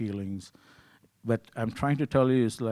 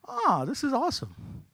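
much higher, thrilled remark; delighted; 14 semitones higher than usual. Retold or imitated. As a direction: gush with delight. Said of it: "I was laughing".

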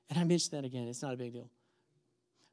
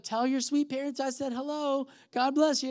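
higher-pitched sweet church lady; 9 semitones higher than usual. Retold or imitated. Woman in car bidding runner goodbye, sweet voice. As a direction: send greetings in an imitated sweet voice. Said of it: "this sweet voice".

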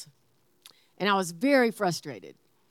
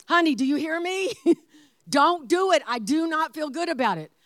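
higher, insistent, loud on "Don't do it"; clear, emphatic repetition; 6 semitones higher, 4 dB louder than usual. Shown as a demonstration. Wife repeating warning so husband is heard, emphatic. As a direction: hammer the warning home in demonstration. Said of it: "then I repeat it in some other manner".

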